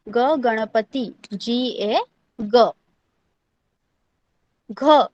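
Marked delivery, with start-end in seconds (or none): 0.1-2.7 ग drawn out slowly, then Ganpati; slow demo pronunciation. Shown as a demonstration. Teacher demonstrating ग slowly for students. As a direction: say it slowly so kids copy. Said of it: "okay".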